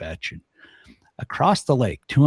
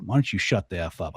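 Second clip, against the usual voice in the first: ordinary talk, nothing marked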